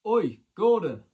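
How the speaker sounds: loud call across the ring walk; shouting his name. Retold or imitated. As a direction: shout to get Gordon's attention. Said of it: "So I shouts".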